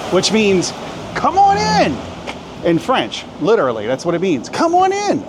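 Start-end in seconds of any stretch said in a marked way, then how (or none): 1.2-2.0 very high sing-out greeting; mock shopkeeper calling customers in, pitched way up; high, held, hammed-up invitation; playful welcome. Playfully translating a shop's welcome sign. 4.5-5.2 very high sing-out greeting; mock shopkeeper calling customers in, pitched way up; high, held, hammed-up invitation; playful welcome. Repeats the playful shop welcome.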